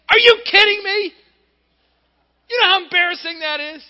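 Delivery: high, loud embarrassed outcry; hammed-up mortified shout; mock outrage. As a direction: yell in hammed-up disbelief. Man mortified at roof plan.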